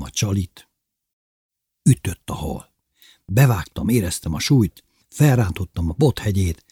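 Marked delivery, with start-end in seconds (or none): none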